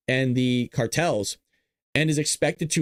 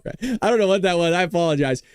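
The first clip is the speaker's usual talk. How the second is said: ordinary delivery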